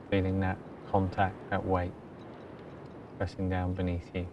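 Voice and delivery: very soft, low-key meditation voice with long pauses; hushed, even delivery, letting each phrase settle; guided meditation. Acted. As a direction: guide them gently into stillness. Leads a meditation.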